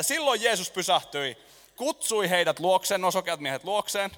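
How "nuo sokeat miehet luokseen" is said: usual speaking voice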